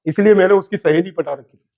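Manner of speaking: playful poetry recital to the class; reciting shayari. Pretend. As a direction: recite it like a mushaira poet, for fun. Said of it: "शायरी सुना दे क्या?".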